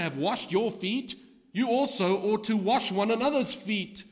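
loud, high, ringing pulpit delivery, slowing on key lines; preaching at full force. Acted. Preacher reading Jesus' words to the church, at full force. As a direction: proclaim each word to the back pews.

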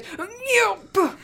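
squeaky high "Nyop!" pop noise; high cartoon pop. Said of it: "And he goes".

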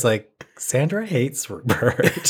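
ordinary delivery

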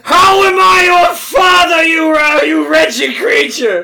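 high, loud hammed-up screaming; mock rage at a baby; furious shout. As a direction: scream it like a furious cartoon villain. Shouting at baby in fic reading.

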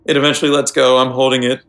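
ordinary talk

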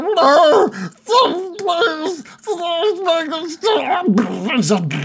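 high, drawn-out pleading wail; hammed-up sobbing plea; crying. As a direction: beg tearfully in a high put-on voice. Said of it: "like, crying".

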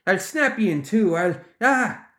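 high, outraged threat broken by a yelp; hollering. A narrator's reading. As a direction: holler a threat, cut off in pain. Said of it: "he hollered, staggering".

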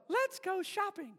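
high sweet doll voice; Barbie voice box. Retold or imitated. Pastor mimicking Barbie's voice box, high and sweet. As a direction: chirp it like a talking doll. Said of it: "and she would go".

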